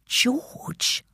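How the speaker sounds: barely voiced whisper; mouthed. Child silently asking Mum in hiding, mouthed whisper. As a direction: whisper it as a question, almost soundless. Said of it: "I mouthed at my mum".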